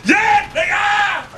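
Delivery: high, drawn-out crowing; triumphant shout. Real, not acted. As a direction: shout out slowly in glee. Crowing over kills.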